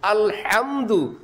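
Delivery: আলহামদু said slowly with the Arabic হা; slow demo of আলহামদু; Arabic ha sound. Shown as a demonstration. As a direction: drag out আলহামদু to show the sound Bengali lacks. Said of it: "সেটা উচ্চারণ করার জন্য".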